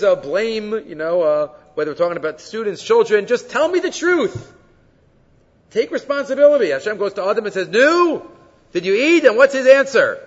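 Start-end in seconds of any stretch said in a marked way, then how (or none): 3.3-6.7 higher, louder scolding appeal; exasperated demand. Acted. Teacher confronting students and children, exasperated demand. 7.7-9.2 higher, louder challenge; confronting question. Acted. Hashem confronting Adam over eating, confronting question.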